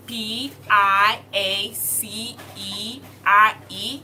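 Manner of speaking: spelled out letter by letter, slow and loud; slow spelling. Shown as a demonstration. Teacher spelling the word slowly, letter by letter. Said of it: "how do we spell piacere?".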